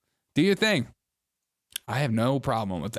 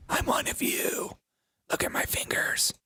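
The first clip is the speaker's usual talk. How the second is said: mock whisper; 4 dB quieter than usual. Voicing the TikToker showing painted nails, whispering. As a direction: whisper it as a sly confession.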